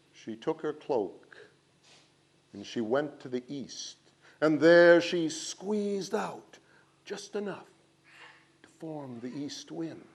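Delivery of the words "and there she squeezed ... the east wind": usual speaking voice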